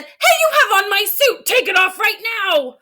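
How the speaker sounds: an outraged boss barking an order; indignant principal. Acted. Principal demanding his suit back, loud and indignant. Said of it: "principal looked at the teacher and said".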